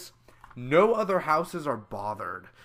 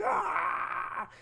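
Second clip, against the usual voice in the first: much higher mock scream; imitated scream. Vlogger imitates the actor's scream at the drill, high yelp. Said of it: "he was like".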